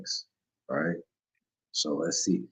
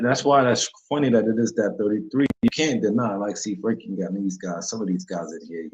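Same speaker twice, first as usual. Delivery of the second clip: usual speaking voice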